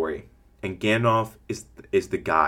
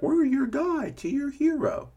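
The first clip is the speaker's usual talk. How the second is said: much higher, slow, put-on announcer voice; cheesy literal slogan; slower than usual. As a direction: ham up a corny slogan, high and drawn out. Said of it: "You don't have to literally be like".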